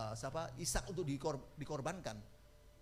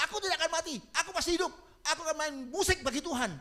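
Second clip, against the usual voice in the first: fervent proclamation; 12 semitones higher, 7 dB louder, faster than usual. Acted. Changed verse for pianist.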